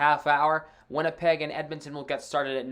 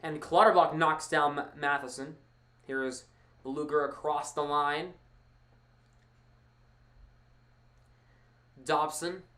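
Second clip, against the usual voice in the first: play-by-play. Acted. Live hockey play-by-play.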